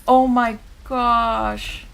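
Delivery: loud, slow stunned disbelief. Real, not acted. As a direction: drag it out in shock. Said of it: "reacting to the reveal".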